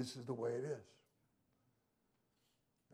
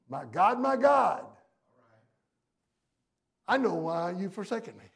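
loud, raised cry to God; retold scream; 4 semitones higher, 11 dB louder than usual. Preacher voicing Jesus on the cross, retold scream. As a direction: cry out loudly, imitating an anguished scream. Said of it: "He screamed out with a what?".

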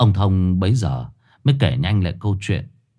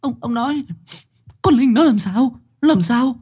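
high, sobbing plea; choked with tears. A narrator's reading. Mother begs her husband about their daughter, high and choked. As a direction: beg for the truth through tears. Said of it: "Bà Lan nghẹn ngào".

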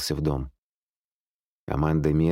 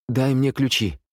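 higher, pressing demand; urgent demand; 7 semitones higher than usual. A narrator's reading. Amanda at the neighbour's window wants keys, urgent demand. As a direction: demand it urgently, voice raised in pitch.

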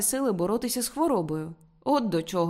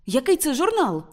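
higher-pitched, excited exclaimed question; crying out; 6 semitones higher, 4 dB louder than usual. A narrator's reading. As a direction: burst out with the question. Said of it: "скрикнув Мартін".

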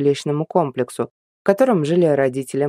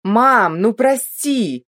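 shouting; 8 semitones higher, much slower than usual. Acted. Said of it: "прокричал он".

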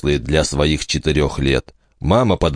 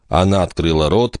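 plain reading voice

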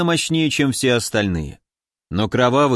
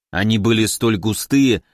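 ordinary delivery, nothing marked

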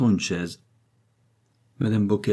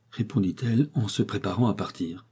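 plain reading voice

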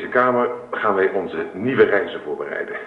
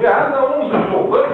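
excited; 5 dB louder than usual. Acted. Husband proudly shows off attic.

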